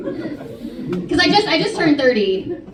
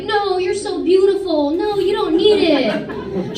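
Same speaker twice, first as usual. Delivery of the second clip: slow, higher mock-sweet Mexican mom; imagined sweet mom; 6 semitones higher, slower than usual. Comic voicing the mom she wished for, slow, higher and mock-doting. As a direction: gush slowly like a doting mom, hammed-up. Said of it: "not once was she like".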